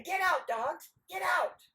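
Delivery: forceful demonstration of a command; firm command. Acted. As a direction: shoo the dogs loudly. Dog owners demonstrate the command.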